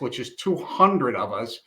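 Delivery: ordinary talk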